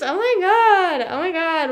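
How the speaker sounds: exasperated excited squeal; excited oh my god. Real, not acted. Reacting to streamer quote.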